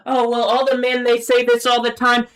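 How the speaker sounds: high-pitched mocking whine of an excuse; mocking imitation. Mimicking the women's excuse about men, mockingly. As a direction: parrot their excuse in a high voice.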